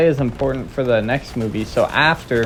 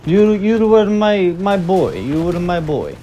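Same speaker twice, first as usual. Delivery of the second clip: put-on high, slow Italian accent; Rocky's Italian accent; 7 semitones higher, 3 dB louder, slower than usual. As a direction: imitate an Italian boxer. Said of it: "his Italian accent is like".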